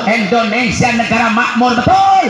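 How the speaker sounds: high shouted call for the crowd's answer; preacher's rallying cry; rousing shout. Acted. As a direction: whip the congregation up. Crowd call-and-response climax.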